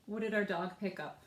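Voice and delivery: usual speaking voice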